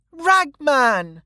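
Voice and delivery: put-on very high little-girl cry of greeting; delighted child. A narrator's reading. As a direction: call out happily as a child running to a friend. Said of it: "laughing and jumping off the chair".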